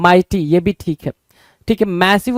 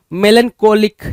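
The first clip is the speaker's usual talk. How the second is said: ordinary delivery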